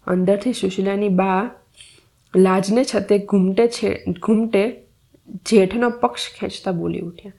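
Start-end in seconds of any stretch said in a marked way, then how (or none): none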